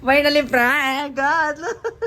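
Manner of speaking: very slow, high and loud, laughing through it. Real, not acted. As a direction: crow with laughter at the success. Laughing after prank succeeds.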